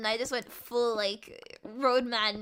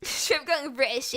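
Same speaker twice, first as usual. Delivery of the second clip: usual speaking voice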